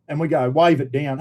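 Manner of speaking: usual speaking voice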